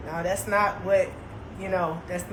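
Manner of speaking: cool them off gently; hushed, unhurried peacemaker; calm voice of reason. Retold or imitated. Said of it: "I be a real good voice of reason".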